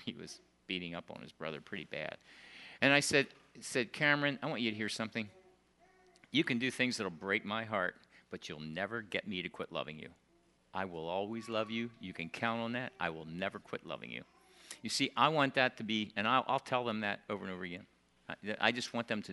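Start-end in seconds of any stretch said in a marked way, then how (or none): 3.9-14.2 low and soft; reassuring grandpa. Retold or imitated. Grandpa promising his grandson, gentle and soft.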